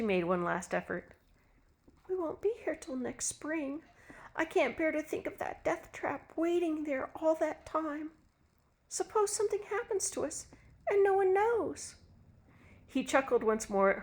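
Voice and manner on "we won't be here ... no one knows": high, hushed anxious woman; anxious wife. Acted. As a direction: make a last worried appeal.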